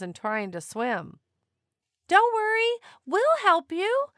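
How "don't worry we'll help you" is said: much higher, louder call across the water; shouted. A narrator's reading. Beach family calling to stranded whales, loud reassuring shout. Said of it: "the people shouted".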